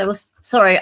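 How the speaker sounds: ordinary delivery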